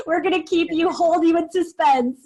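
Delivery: high, giddy teasing; gleeful, drawing out the suspense; playful tease. Real, not acted. Teasing listeners about the secret challenge.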